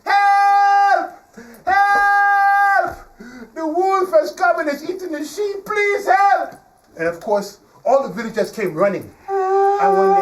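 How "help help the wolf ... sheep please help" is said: high, loud drawn-out cries of alarm; acted boy's urgent holler; yelling for help. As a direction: scream it down to the whole village. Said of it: "he yells down the village".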